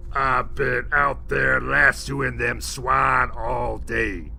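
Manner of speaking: slow, loud cowboy complaint; gruff cowboy. Acted. The cowboy telling David off, slow and loud. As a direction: drawl it out as the cowboy.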